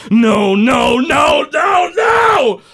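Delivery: hammed-up villain scream climbing up; rising furious shout. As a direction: explode in rage as he attacks. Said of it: "He goes".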